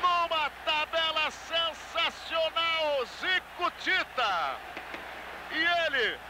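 long, high, stretched goal shout; explosive goal commentary. Real, not acted. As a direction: roar the goal call, dragging every word. Goal scored, commentator erupts.

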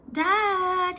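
put-on boy calls his dad to come up, loud and high; calling out. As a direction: call Dad from bed. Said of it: "he yelled".

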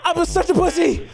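high, loud, worked-up venting after the scare; excited yelling. Real, not acted. Streamer to a friend after dying in game, loud and high-pitched. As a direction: blurt it all out, still buzzing from the fright.

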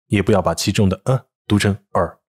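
normal talking voice

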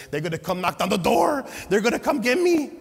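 loud, high frantic fear; terrified he's about to be caught, loud and high; panicked. Retold or imitated. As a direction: blurt it out in a panic. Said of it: "I'm over there like".

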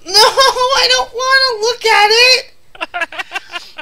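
high, drawn-out panicked plea; panicked whine. Real, not acted. Streamer refusing to look at the spider, panicked whine. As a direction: wail it slowly in dread.

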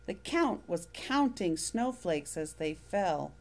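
ordinary delivery, nothing marked

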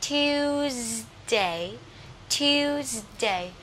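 "Tuesday" slowly, with a drawn-out "tu" and buzzing s; long-u pronunciation model. Shown as a demonstration. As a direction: stretch the first syllable of "Tuesday". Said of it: "U nè, tu". (đọc mẫu "Tuesday", kéo dài âm u)

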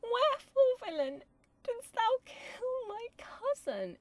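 very high, drawn-out lament; plaintive, slow, hammed-up grief; mock sad Juliet. Shown as a demonstration. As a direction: overplay the helpless young tragic heroine. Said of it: "about her being so sad".

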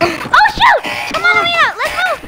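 high, loud, urgent; alarmed. Acted. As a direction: rush everyone out in panic. Alarm rings again.

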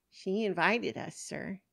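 lightly voiced child answering politely; little girl. Costumed girl telling policeman about mommy, light child voice.

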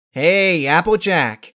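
loud, high friendly hail; bright loud greeting; calling out. Acted. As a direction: call across the field. Rainbow Dash calling out.

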